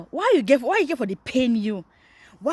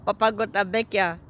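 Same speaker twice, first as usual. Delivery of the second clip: ordinary talk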